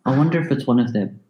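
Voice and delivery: ordinary talk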